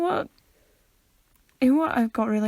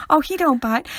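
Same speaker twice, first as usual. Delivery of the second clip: Vine quote; 4 semitones higher, 7 dB louder than usual. Retold or imitated. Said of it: "quote Vines".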